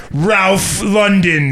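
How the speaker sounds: slow, drawn-out Russian-style name; mock Russian accent. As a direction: stretch it out like a Russian. Mangling name, Russian joke.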